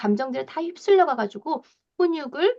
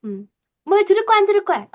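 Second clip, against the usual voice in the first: higher and louder, fed-up scolding; angry mom scolding; 8 semitones higher, 5 dB louder, faster than usual. Retold or imitated. Mom losing her temper at a child who ignores her, angry scolding. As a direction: snap at the child who won't listen. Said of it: "확 열이 받으면서".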